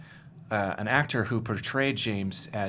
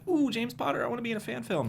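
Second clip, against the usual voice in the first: very high, squealy fan voice; mock gushing fan; 14 semitones higher, faster than usual. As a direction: parody an over-eager fan wanting a part. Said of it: "rather than be like".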